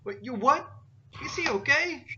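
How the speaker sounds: high-pitched incredulous questions; shocked, high child voice; alarmed boy. Acted. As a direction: blurt it out as a worried kid. Boy hears his father was sent off.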